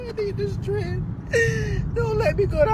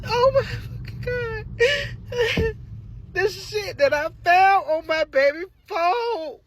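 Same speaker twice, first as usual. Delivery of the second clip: ordinary delivery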